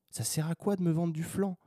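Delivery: fed-up rhetorical question, pitched high. Real, not acted. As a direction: pitch it up, exasperated. Repeats question with more force.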